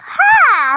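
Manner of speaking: very high "ha" sliding down; imitated high-pitched yelp; mock startled cry. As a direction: let out a shrill comic "haaa". Imitating a startled cry on seeing someone.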